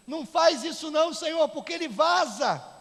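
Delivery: loud, high yell from the back; heckler's shout. Acted. Voice from the back protesting to God, shouting. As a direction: holler it urgently across a whole church. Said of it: "uma voz lá de trás gritou assim".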